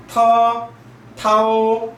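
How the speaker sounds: 타오 held high and level; first tone. Shown as a demonstration. Teacher reading te and tao in the first tone. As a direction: say 터 and 타오 high and flat like a Mandarin first tone. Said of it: "타오 일성으로요".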